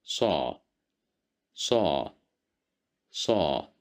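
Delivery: صا said three times, emphatic s with open a; صا repeated as a model; syllable drill. Shown as a demonstration. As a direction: drill صا slowly for the class. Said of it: "we will pronounce it as صا".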